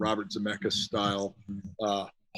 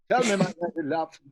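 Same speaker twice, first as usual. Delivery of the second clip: lightly voiced theatrical English vampire, a touch higher; Laszlo impression; 6 semitones higher than usual. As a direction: croon it like a pompous old-world lover, hinted only. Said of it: "He plays Laszlo".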